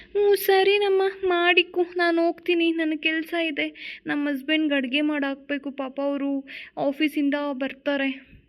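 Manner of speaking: a busy neighbour excusing herself; chatty neighbour woman. Acted. Neighbour telling the wife she must go cook, brisk.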